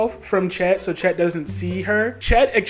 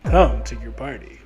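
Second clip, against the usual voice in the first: deep voice; 8 semitones lower than usual. Retold or imitated. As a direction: drop the voice deep. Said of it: "And she's like".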